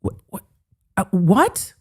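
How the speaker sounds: ordinary talk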